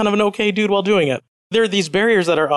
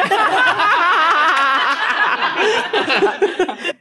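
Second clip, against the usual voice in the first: high burst of laughter; 13 semitones higher than usual. Real, not acted. S2 cracks up at audience joke.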